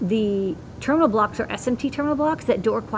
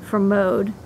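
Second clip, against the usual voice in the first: ordinary talk, nothing marked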